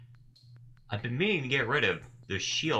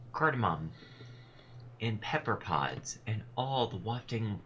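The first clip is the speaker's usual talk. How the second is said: rhythmic poem recital; 4 semitones higher than usual. Acted. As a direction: let each image hang like a spoken poem. Reads the tall woman's poem.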